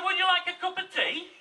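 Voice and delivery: high, loud hospitable offer; eager host. Acted. Householder offering tea to surprised callers, acted eager host. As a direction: play an over-keen host, pitched right up.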